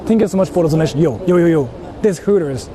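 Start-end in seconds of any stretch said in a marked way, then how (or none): none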